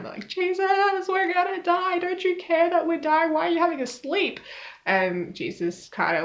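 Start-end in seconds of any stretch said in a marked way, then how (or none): 0.3-4.4 high-pitched mock panic; hammed-up high panicky voice; freaking out. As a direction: whine it high, like panicked disciples pleading for help. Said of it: "really, really freaking them out".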